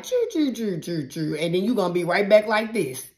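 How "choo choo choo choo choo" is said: mock train noise. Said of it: "God gonna be like".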